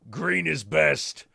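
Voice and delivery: put-on slow orc chant in Aussie accent; outback Aussie orc. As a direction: chant it like an uneducated Australian orc. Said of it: "uneducated outback Aussies".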